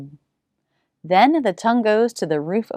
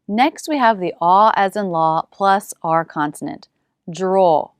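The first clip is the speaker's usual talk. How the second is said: usual speaking voice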